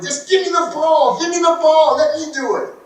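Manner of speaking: high, loud insistent demand; demanding kid. Retold or imitated. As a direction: beg for the ball, impatient. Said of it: "I'm like".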